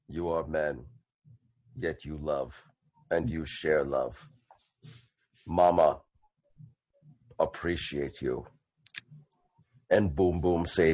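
low, quiet and slow, a gentle giant's blessing; deep, hushed, drawn-out proclamation; solemn brute voice. Acted. Boom Boom blesses the couple.

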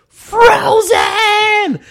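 shrieked Frozen, way up high and loud; excited little girl. Retold or imitated. Young girls scream for their favorite film, shrieking high. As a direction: squeal like a thrilled kid.

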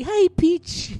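high, silly German voice; German accent. Retold or imitated. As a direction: imitate a squeaky comic German. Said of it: "his German voice".

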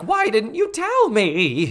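high, drawn-out theatrical wail; hammed-up indignation, stretched and high; mock indignant Missus Garrett. Missus Garrett demanding answers from Miko, mock indignant wail. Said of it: "and she's like".

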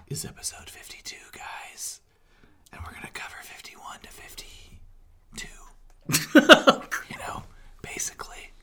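soft unvoiced whisper. Real, not acted. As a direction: whisper it like a secret. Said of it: "I don't know why I'm whispering".

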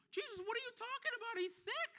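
quick, high-pitched mock disbelief; baffled protest. Puzzled reader challenging Jesus about sick Lazarus, mock incredulous. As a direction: protest as if it makes no sense.

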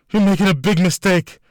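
high, loud desperate scream. A narrator's reading. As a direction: scream the warning. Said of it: "the handcuffed woman screamed".

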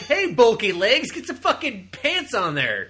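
high, loud, slow heckling; hammed-up loud jeer; mock heckle. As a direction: call it out like a rude fan. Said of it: "I'm just like".

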